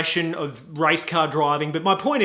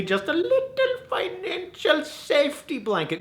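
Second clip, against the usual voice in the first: tiny squeaky voice; 11 semitones higher, 3 dB quieter than usual. Acted. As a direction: shrink it to a teeny squeak. Squeezes phrase, then repeats it normally.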